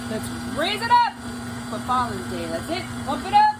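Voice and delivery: high, loud pump it up; rally the crowd; cheering as the mixer starts; hype cheer. Acted. Firing up the mixer.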